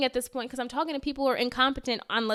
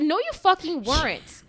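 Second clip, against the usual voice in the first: slow, louder, furious retort; retold angry retort; 6 semitones higher, 4 dB louder, slower than usual. Traveler snapping back at the lying airline agent, furious. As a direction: spit it back at her.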